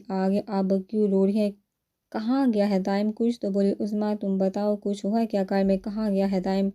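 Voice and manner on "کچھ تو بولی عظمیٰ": ordinary delivery, nothing marked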